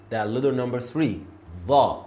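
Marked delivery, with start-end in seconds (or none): 1.7-2.0 'the' with tongue at the front teeth, louder. Shown as a demonstration. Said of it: "Look how I say this letter".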